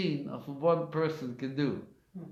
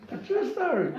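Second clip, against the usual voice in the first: high, laughter-filled retelling; amused, pitched up by laughing; laughing while talking; 11 semitones higher, 4 dB louder than usual. Real, not acted. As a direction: talk through real laughter. Laughing while telling the story.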